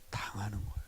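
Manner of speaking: preacher's dramatic whisper; hushed whisper. Acted. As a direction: drop to a breathy whisper on the last words. Sermon point, handed over.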